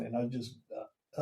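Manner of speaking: ordinary delivery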